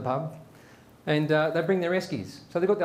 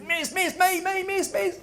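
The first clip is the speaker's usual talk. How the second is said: high-pitched schoolkid begging to be picked; eager pupil; 12 semitones higher, slower than usual. Acted. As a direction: squeal for the teacher's attention. Johnny out of his chair, desperate.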